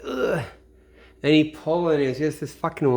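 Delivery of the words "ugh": high straining grunt, mock; strained rabbit grunt. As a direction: pull hard against the wire. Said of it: "He's like".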